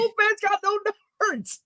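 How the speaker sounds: high mock protest; protesting kid. Kid argues about Wolfman's anatomy, high mock protest. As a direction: imitate a kid objecting, pitch way up.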